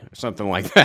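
usual speaking voice

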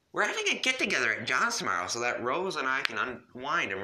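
put-on high, bright teen girl; girly voice. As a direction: pitch up for Jade. Jade voiced again.

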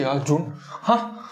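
ordinary talk, nothing marked

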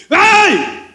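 high-pitched drawn-out yell of "ay"; imitated shout. Preacher imitates brothers yelling on the outing, high mock shout. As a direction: imitate people yelling out in the street. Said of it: "hermanos gritaban".

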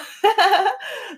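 high-pitched burst of laughter; amused laugh. Real, not acted. Amused at celebrities' politics app.

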